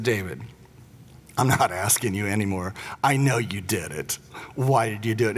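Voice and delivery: quiet, no-nonsense dad who already knows; stern dad. Retold or imitated. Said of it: "finally I just switched, you know, strategies".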